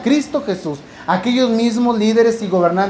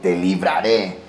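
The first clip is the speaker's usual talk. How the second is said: te libraré dropped very low; deep promise; 13 semitones lower, slower than usual. Acted. The Lord promises rescue in a very deep voice.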